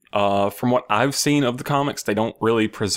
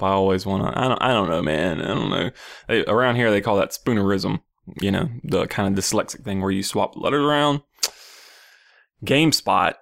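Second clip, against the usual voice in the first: ordinary talk, nothing marked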